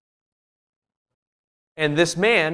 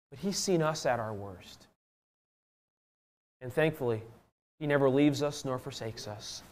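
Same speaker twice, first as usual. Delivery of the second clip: soft, low and solemn; hushed preaching; 3 semitones lower, 8 dB quieter than usual. Real, not acted. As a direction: drop down to a quiet, sober assurance.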